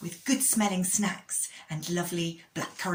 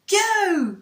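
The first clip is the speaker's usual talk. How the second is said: loud excited start call; shouting go; 13 semitones higher, 12 dB louder than usual. Acted. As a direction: yell it to start the race. Race start, calling out.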